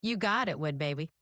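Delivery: emphasized Southern US drawl. Pretend. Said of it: "really emphasize the Southern drawl".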